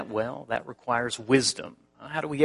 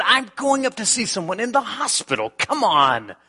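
exasperated plea; 8 semitones higher than usual. Retold or imitated. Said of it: "I said".

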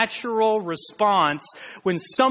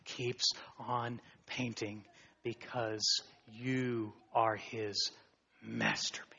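hushed reverence; 5 semitones lower, 14 dB quieter than usual. Acted. Tender hushed climax.

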